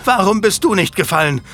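high, loud, hysterical screaming; screaming rage. A narrator's reading. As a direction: scream it at her son's killer. Marguerite screams at Julian.

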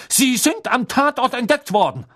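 high, strained fury; half-crazed, high-pitched outrage; slightly unhinged accusation. A narrator's reading. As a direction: accuse like someone losing control. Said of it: "So wirkte er leicht übergeschnappt".

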